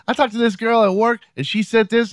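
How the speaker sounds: very high put-on woman's voice; imitated girlfriend gossiping. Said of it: "her friend would say".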